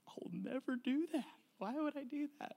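high, soft mock voice of his younger self; lightly mocking his own past resolve; mock naive self. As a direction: squeak it out like a starry-eyed dad who never spanks. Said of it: "I'm like".

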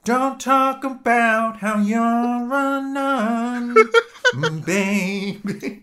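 high, slow, laughing sexy voice; sultry mock voice. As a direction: purr it, hammed-up and giggling.

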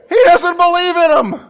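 sudden loud high punch; emphatic shout. Real, not acted. Preacher to congregation, a loud emphatic shout. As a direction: hammer the point home loudly. Preacher worked up, climactic line.

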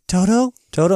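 high, questioning mock call. Dorothy hears her dog barking, calls out questioningly.

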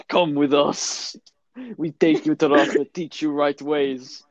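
slow, hammed-up Russian accent; mock Russian accent. Russians at the door taking pupil away, slow mock Russian accent. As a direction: play a Russian heavy, drawn out.

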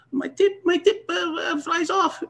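high, squeaky outraged voice; upset Filipino player. Retold or imitated. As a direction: squeal it like a panicked old pro.

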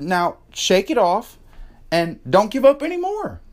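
high, rousing coach's order; retold pep talk. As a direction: act out sending a kid back into play. Retold firm pep talk to daughter.

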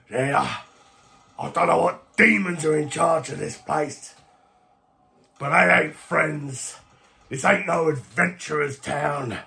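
put-on rough-spoken character; resigned, ominous warning; grim captive. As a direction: warn a friend gravely. GM plays captive warning friend.

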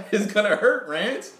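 high laugh bursting into the words. Real, not acted. Friend laughing at the thought of it hurting.

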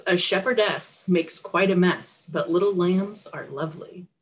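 usual speaking voice

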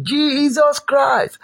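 high, slow calling cry; drawn-out shout. Shown as a demonstration. Preacher demonstrating the prayer shout, drawn-out cry. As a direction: call the name out long and high. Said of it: "You shout".

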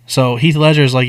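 ordinary talk

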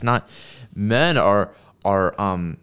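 ordinary talk, nothing marked

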